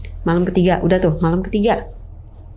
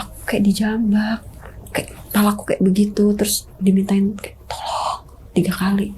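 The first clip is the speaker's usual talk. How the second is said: hushed retelling. Retold or imitated. Wife whispering to her husband what happened. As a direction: tell it under your breath.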